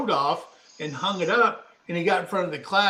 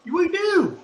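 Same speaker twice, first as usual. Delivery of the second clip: high drawn-out "we do"; insistent, high-pitched yes; emphatic agreement; 13 semitones higher than usual. Real, not acted.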